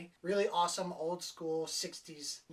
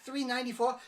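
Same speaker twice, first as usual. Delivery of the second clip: "Three ninety-four?" asked higher and louder with a rising disbelieving lilt; shocked; 6 semitones higher, 5 dB louder, faster than usual. Retold or imitated. Girl hearing the coat price, mock shocked. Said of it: "She's like".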